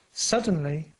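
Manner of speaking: usual speaking voice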